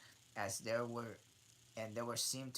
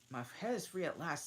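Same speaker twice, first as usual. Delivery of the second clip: delighted; 9 semitones higher, 4 dB louder, much faster than usual. Acted. Alice delighted line.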